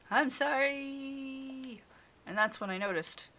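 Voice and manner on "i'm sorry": high, long-drawn wail of sorry; wailed apology. Acted. Scolded visitor told to mean it, wailing apology. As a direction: stretch the apology out into a hammed-up wail. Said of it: "Say louder. Say it like you mean it".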